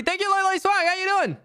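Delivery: sudden high-pitched greeting; excited thanks. Real, not acted. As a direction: burst into a squeaky, quick thank-you. Breaks off to greet a supporter.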